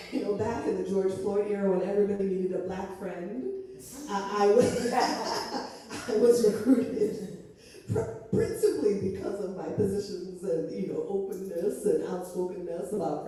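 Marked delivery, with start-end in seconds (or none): none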